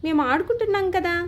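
put-on children begging their father to wait; children clamoring. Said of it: "పిల్లలు ఇద్దరూ సంయుక్తంగా గోల పెట్టారు".

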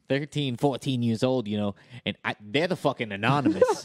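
put-on worked-up, out-of-touch New Yorker accent. Clueless podcast guest warning about masked kids, New York accent. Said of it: "from New York, obviously".

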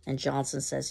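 ordinary delivery

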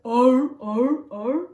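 loud repeated walrus barks; walrus bellow. Shown as a demonstration. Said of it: "And bellowing like a walrus?".

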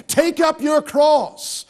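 solemn dramatic quotation; emphatic quote. Acted. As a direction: lay the words down heavy and slow. Preacher quotes Jesus, emphatic.

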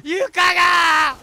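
slow, high, loud wail; stretched shout. Real, not acted. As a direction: drag out the word in a loud cry. Floor burning, repeated. (引き伸ばした叫び)

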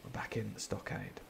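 hushed whispered aside; a confiding whisper to the listener. Real, not acted. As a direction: lean in and whisper it.

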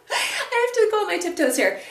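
high, laughing through the words. Real, not acted. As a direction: giggle while saying it. Laughs while reaching on tiptoes.

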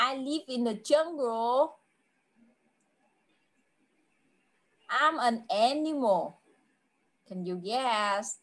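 put-on slow, spaced-out English for a young learner; teacher's unhurried riddle delivery; slow riddle clues. Shown as a demonstration. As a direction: give each clue slowly, leaving time to guess. Teacher gives riddle clues to child.

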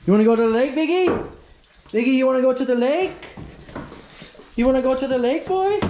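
high, bright-eyed, sing-song call to the dog; excited dog talk. Real, not acted. Owner calling his dog Biggie to the lake, excited high voice.

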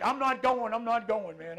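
loud, high whiny refusal from a father; stubborn dad refusing. Retold or imitated. Dad refusing his doctor's appointment all weekend, loud and whiny. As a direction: dig in like a dad who won't go. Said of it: "all weekend's like".

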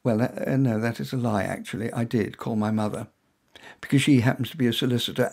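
plain reading voice

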